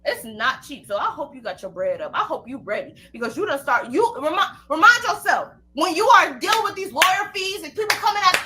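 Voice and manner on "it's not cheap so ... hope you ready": quiet threat. Real, not acted. Quiet menacing warning.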